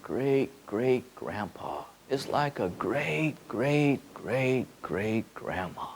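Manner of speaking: soft, slow, gentle; tender elder. Acted. Evoking a great-grandma watching a child grow, soft and slow. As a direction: speak like a loving great-grandparent.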